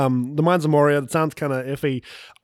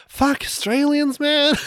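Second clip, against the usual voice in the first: mock outburst; 10 semitones higher, slower than usual. Real, not acted. Joking outburst.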